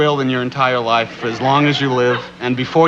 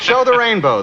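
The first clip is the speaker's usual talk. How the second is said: mock company slogan; 8 semitones higher than usual. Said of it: "they said".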